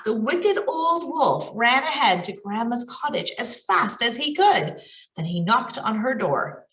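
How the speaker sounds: ordinary delivery, nothing marked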